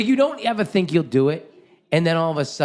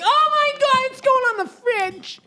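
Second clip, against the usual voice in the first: very high, ecstatic mock squeal; hammed-up delighted mother; gushing mom. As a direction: shriek with joy over a child's gift. Acting out delighted mother receiving painting.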